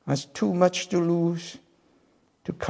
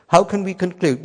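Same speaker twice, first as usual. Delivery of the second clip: ordinary talk, nothing marked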